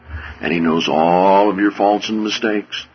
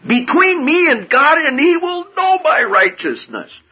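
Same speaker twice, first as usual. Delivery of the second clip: hammed-up indignant protest; voicing Job's angry grievance against God, pitched high. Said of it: "he got so angry".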